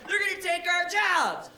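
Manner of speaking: high, loud panicky yell; barroom doomsayer. Retold or imitated. Bar patron panicking about AI, high mock shout. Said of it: "everybody's like".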